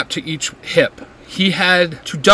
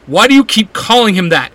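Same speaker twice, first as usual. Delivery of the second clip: demanding question; 5 semitones higher, 6 dB louder than usual. A narrator's reading. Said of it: "Izzy asks".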